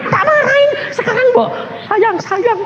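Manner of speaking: high, exasperated mock complaint; indignant protest. Pastor protests God's kindness after ordered scolding, indignant. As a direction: protest upward in growing disbelief. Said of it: "Aku protes, saya bilang".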